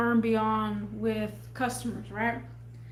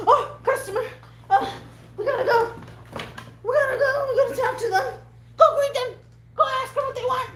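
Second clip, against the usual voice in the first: hammed-up boss pushing her to the customers; frantic boss imitation; 12 semitones higher, faster than usual.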